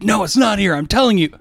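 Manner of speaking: put-on high, insistent child's protest; frustrated kid. Kid to parent about a missing toy hat, insistent protest. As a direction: imitate a kid sure the piece is missing.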